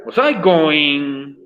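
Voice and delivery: slow, deliberate model phrase; slow demo. Shown as a demonstration. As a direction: lay out each word for the class. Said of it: "por ejemplo". (frase modelo lenta y pausada)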